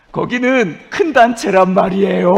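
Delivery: high, slow wailed grievance; mock-outraged yell, dragging the words; resentful shout. Preacher grumbling to God about the big charity, resentful shout. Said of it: "계속 하나님을 원망했죠".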